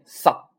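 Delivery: さ said loud and cut off at once; abrupt stop. Shown as a demonstration. Teacher shows catching the sound, abrupt stop. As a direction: choke さ off and hold it. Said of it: "你看收住这个音".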